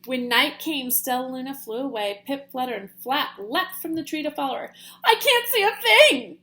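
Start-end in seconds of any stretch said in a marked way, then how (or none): 5.0-6.4 high, loud panicked yell. Acted. Pip flying blind in the dark, yelling. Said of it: "yelled Pip".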